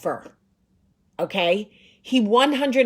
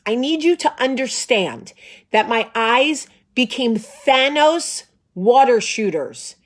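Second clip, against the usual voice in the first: ordinary delivery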